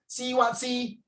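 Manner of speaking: high-pitched Singlish retort; Singlish demo. Shown as a demonstration. Teacher showing students a Singlish phrase, challenging Singlish tone. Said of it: "Very Singlish".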